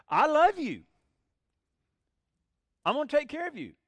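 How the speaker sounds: a big ringing I love you; God's declaration of love. Acted. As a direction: proclaim it boldly to the people.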